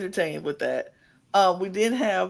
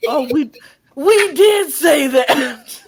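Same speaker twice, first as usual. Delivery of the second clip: laughing; 7 semitones higher, 8 dB louder, slower than usual. Real, not acted. Laughing admission.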